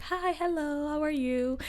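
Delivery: high, sing-song greeting of an eager lady; imitating a stranger. Wife imitating the store lady, a high chirpy greeting. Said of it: "She was like".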